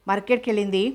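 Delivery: ordinary delivery, nothing marked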